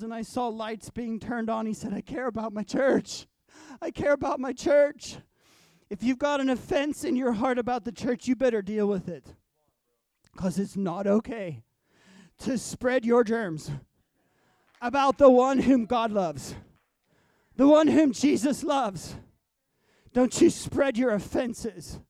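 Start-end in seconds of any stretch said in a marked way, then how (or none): 1.9-5.2 rising insistence. Retold or imitated. God's words, rising insistence. 5.9-22.0 louder, preached warning that presses hard; stern warning. Retold or imitated. God warning the church sternly.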